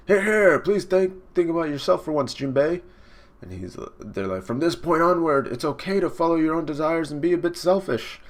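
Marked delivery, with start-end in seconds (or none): 0.1-2.8 high, loud opening that settles slightly; eager high-pitched sidekick; excited crewman. Acted. Fishmen voiced urging their captain. 4.4-8.2 lightly voiced sidekick, pitched up; a hint of eager crew member; encouraging crewman. Crew voiced encouraging their captain.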